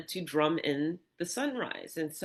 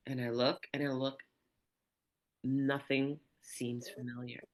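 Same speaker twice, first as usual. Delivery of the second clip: usual speaking voice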